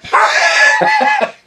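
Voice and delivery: high laugh. Real, not acted. Laugh after retold story.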